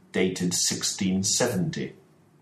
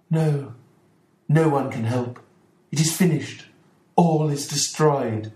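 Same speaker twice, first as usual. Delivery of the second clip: despairing; 7 semitones higher, 3 dB louder than usual. Acted. Mrs Ferguson telling Watson nobody can help, despairing. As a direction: give up all hope.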